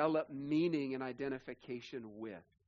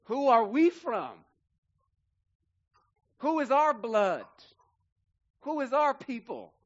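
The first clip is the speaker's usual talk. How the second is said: much louder and higher, each question hammered out; rising preacher's cry; 6 semitones higher, 8 dB louder than usual. Acted. Preacher asks the congregation who their people are, loud and soaring. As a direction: call it out to the whole room like a challenge.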